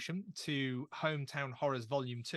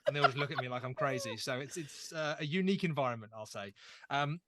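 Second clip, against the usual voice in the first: ordinary talk, nothing marked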